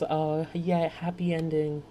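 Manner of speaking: a sugary fake happy ending; mock cheerful.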